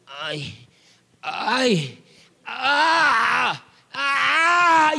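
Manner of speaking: imitated pained "ay" swelling into a drawn-out cry; groan building to cry. Man in the middle of prayer starts crying out, imitated rising wail. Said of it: "y empieza a gritar".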